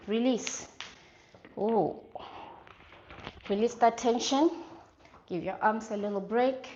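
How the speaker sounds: punchy coach calls over music; halftime pep speech. Real, not acted. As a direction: bark out each move to the class. Instructor calling workout cues.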